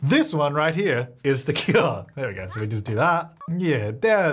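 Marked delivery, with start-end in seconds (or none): none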